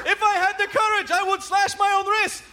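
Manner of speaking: high-pitched mock madman salesman; hammed-up local TV commercial voice; Crazy Gideon. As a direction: pitch the deals like a deranged appliance dealer. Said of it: "focused on the idea of sanity".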